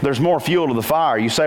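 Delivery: ordinary talk, nothing marked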